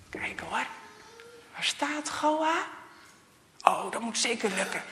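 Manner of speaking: sneaky aim. A narrator's reading. Thijs takes aim secretly.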